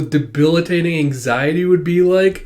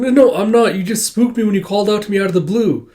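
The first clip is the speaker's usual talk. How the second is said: high, hurried flustered protest; flustered denial; 7 semitones higher, 5 dB louder than usual. Acted. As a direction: rush to deny it, startled. Flustered denial of being lost.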